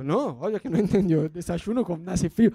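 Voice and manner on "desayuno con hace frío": ordinary delivery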